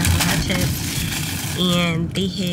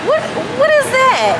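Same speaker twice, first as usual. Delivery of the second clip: high, sing-song mom voice, pitched way up and louder; 5 dB louder than usual. Real, not acted. Mom quizzing her toddler.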